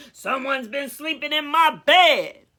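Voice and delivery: Papa Bear finding his bed used, mild growl. A narrator's reading. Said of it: "growled Papa Bear".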